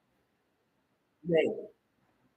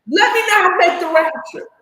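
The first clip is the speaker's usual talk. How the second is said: raised, high declaration; loud vow; 9 semitones higher, 7 dB louder than usual. Real, not acted. Vowing to her viewers, loud and high. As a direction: swear it out loud, pitch up.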